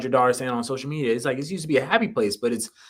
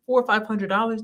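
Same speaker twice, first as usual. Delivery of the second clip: higher-pitched astonished question; retold reaction, raised and incredulous; incredulous echo; 8 semitones higher than usual. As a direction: repeat the price back in disbelief. Retold disbelief at cheap rent.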